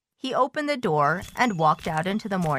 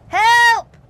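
high, loud cry for help; panicked cry; 11 dB louder than usual. A narrator's reading. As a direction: cry out sharply in alarm. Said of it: "cried Toad".

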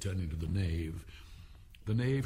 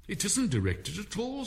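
high rabbit voice; 11 semitones higher than usual. Acted. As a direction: squeak it out quickly.

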